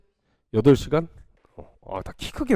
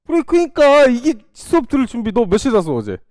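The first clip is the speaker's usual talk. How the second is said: emphatic point; 8 semitones higher, 5 dB louder than usual. Real, not acted. Teacher lecturing about sleep.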